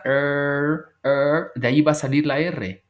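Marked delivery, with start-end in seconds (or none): 0.0-1.5 "er" with the tongue pulled back; English r sound. Shown as a demonstration. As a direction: curl the tongue for "er". ("er" con la lengua jalada atrás)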